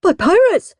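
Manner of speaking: high, breathless-sounding exclamation; gasping. A narrator's reading. Gordon explains to Mum, high alarmed gasp. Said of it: "gasped Gordon".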